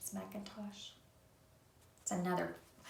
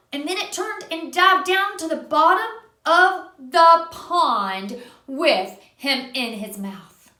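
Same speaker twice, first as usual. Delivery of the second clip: louder, slowing at the end; storyteller drawing out the danger; dramatic narration; 4 semitones higher, 8 dB louder than usual. A narrator's reading. Reading a picture book aloud.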